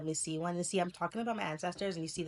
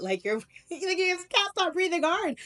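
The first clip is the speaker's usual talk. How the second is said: laughing; 13 semitones higher, 8 dB louder than usual. Real, not acted. Laughing at own fear.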